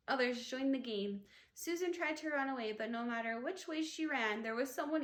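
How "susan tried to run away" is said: ordinary delivery, nothing marked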